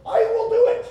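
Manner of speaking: very high, loud acted exclamation; hammed-up, emphatic volunteering; emphatic declaration. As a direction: proclaim it at the top of the voice. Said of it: "this emphasis".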